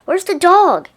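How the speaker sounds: high, loud yell. A narrator's reading. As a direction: yell out searching. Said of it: "yelled another".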